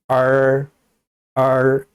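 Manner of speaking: 얼 said with the tongue curled up, held level; sustained, even 얼 with a Mandarin er colouring; curled-tongue retroflex. Shown as a demonstration. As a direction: curl the tongue back on 얼. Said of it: "혀를 말아 올리고 발음하면 돼요".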